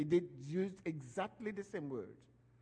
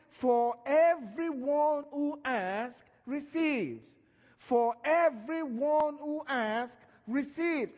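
preaching at full force; 7 semitones higher, 4 dB louder, much slower than usual. Real, not acted.

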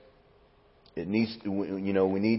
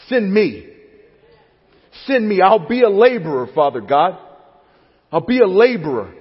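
high, loud eager cry; shouted preaching plea; here am I; 8 semitones higher, 7 dB louder than usual. Acted. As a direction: plead to be sent, rising fervor. Preacher voicing call and answer.

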